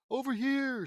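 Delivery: high, loud answering shout; calling back. A narrator's reading. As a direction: holler back across the distance. Answering a yelled call.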